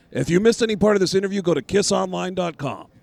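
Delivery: fast, loud, high announcer read; radio plug. Acted. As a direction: hype it like a radio promo. Plug at end of interview.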